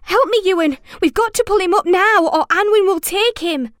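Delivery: high, loud, urgent plea; panicked urging. A narrator's reading. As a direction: cry out for help in a panic. Desperate rescue, calling for help.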